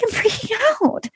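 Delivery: high-pitched mock wail; mock crying. [name] answers worried producers, mock crying. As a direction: wail it in a put-on tearful panic. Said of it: "She's crying".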